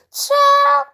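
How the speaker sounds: high, drawn-out squeal of disbelief; mocking exclamation. Real, not acted. Vlogger scoffing at the keys story, mocking high squeal. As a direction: let it soar way up and hang.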